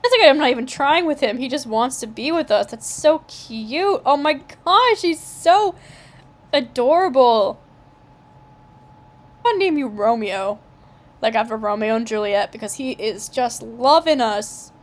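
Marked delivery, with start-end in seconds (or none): 2.7-10.6 gushing. Real, not acted. Gushing over the wolf.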